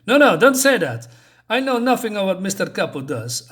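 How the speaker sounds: high, loud protest; protesting thug. Retold or imitated. Thug to the fairy, loud high protest. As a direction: cut her off, nervous and loud.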